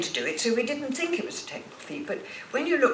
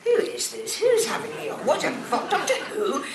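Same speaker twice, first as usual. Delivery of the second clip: higher, laughing disbelief; retold disbelief; 5 semitones higher than usual. Retelling the magazine call, laughing in disbelief. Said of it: "I said".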